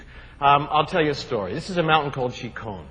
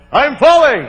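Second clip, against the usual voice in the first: mock climber's yell, much higher and louder; yelled warning; 9 semitones higher, 10 dB louder than usual. Instructor's advice, shouted as a mock falling cry. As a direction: belt out the warning like you're falling. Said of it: "you must alert your partner and yell".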